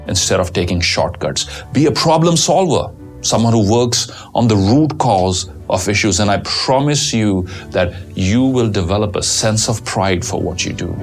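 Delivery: ordinary talk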